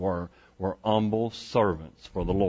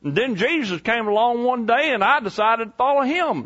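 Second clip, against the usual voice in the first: put-on high, eager country-boy fisherman voice; John as country boy; 11 semitones higher than usual. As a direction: play a folksy disciple, pitched way up. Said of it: "I know how they talked".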